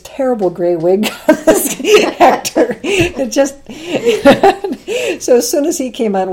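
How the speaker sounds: can't get it out for laughing. Real, not acted. Telling the host about the awful gray wig, laughing hard.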